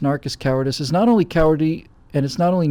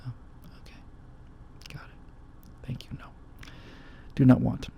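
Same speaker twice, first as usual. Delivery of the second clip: whispered, pulling away from the speaker; hushed, recoiling mock reply; 4 semitones lower, 13 dB quieter, slower than usual. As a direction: breathe it, backing off from an unwanted touch. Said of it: "It's like".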